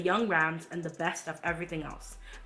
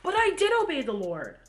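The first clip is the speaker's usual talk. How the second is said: louder, much higher protest; defensive Saul; 5 semitones higher, 5 dB louder than usual. Acted. Saul protesting to Samuel he obeyed, loud and high. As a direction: insist you obeyed, voice rising. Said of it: "Then Saul goes".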